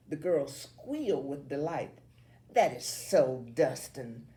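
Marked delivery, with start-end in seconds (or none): 2.5-4.3 a smirking gush. A narrator's reading. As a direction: say it with a knowing smirk. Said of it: "Allison smirks".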